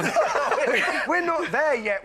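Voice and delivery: high-pitched, laughing through the words; laughing protest. Real, not acted. Host to contestants asking when the bar opens, laughing protest. Bar joke early in quiz.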